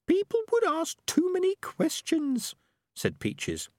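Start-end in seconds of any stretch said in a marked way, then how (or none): 0.1-2.5 very high, slow squeaky rat voice; prim little rat. A narrator's reading. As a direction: scold him gently from way up high. Peaches voiced.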